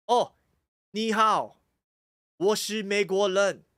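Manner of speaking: American-accented Mandarin, a bit loud. Shown as a demonstration. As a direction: imitate an American speaking clumsy Mandarin.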